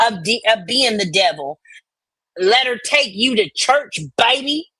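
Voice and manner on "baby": ordinary delivery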